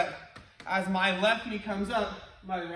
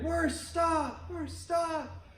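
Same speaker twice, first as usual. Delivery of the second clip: fading echo; 10 semitones higher, much slower than usual. Retold or imitated. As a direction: throw it back like a distant echo. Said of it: "And they heard".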